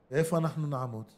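usual speaking voice

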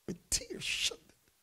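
a voice cracking high then whispered; tearful break. Real, not acted. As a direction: let it break with tears.

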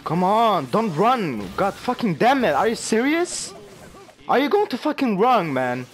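real frustration, loud and high; exasperated outburst. Real, not acted. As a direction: yell at the player running away. Gamer yelling at fleeing opponent.

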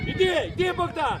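high, loud call to move; urging shout. Real, not acted. Coach sending Богдан forward, shouting. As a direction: drive the player forward with a yell.

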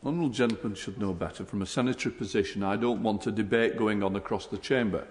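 stern rebuke. Real, not acted. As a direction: scold the member from the chair. Speaker rebukes the member.

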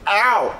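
high, loud "ow"; sudden loud cry of pain; real pain yelp. Real, not acted. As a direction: yelp at a sudden sharp sting. Wax stick pulled from nostril.

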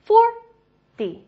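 "forty" with "FOR" high and loud, then light "dee"; stress on FOR. Shown as a demonstration. Teacher drilling tens, first syllable punched high. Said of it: "重音都是哒哒".

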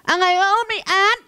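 high, slow, slurred "ไม่อาร์ต" for ไม่ชัด; short-uvula speech. Acted. Teacher imitates child with short uvula, slurring "ไม่อาร์ต". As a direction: imitate unclear child speech, "ไม่อาร์ต" losing its first consonant. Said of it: "พอลิ้นไก่สั้น".

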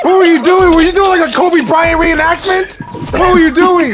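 laughing disbelief. Real, not acted. Disbelief at teammate's failed flight.

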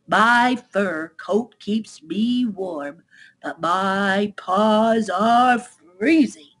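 very slow, drawn-out chilly otter. Acted. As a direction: drag out each word as a freezing otter. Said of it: "replied the otter".

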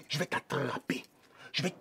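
souffle la menace à voix basse; menacing whisper. Acted. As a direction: murmure glaçant de menace. Menacing threat, voice drops to breath. (chuchotement menaçant)

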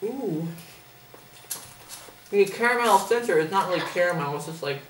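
chewing between words; eating a biscuit while talking; mouth partly full. Real, not acted. Seen 1: Woman holds bitten biscuit, bit at -4s, chews while describing filling.

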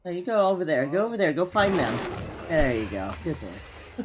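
low, gentle mom praising a dog; motherly voice. Acted. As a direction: coax him like a mother. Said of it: "I'm like talking to him like a mother".

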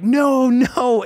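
high, urgent mock shout; retold screaming. Breaking up fighting kids, retold screaming. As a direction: act out yelling to stop a fight. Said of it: "I'm screaming".

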